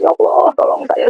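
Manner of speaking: high-pitched, retold cry for help; tearful plea. Woman crying on the beach begs God, tearful. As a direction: re-enact pleading tearfully to God. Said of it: "Saya menangis".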